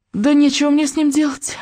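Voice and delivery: put-on high, fed-up old woman; grandma waving it off; dismissive grandmother. Grandma waves it off.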